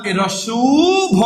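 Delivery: উ stretched long before ভ; slow spelling-out of উ ভ; sound demonstration. Shown as a demonstration. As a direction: draw out "uuu" then land on bho. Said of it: "আমরা দেব উভ".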